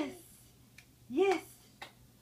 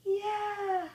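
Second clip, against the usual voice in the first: excited gasp; 6 semitones higher, 4 dB louder than usual. Real, not acted. Baby reaches up, parent cheers.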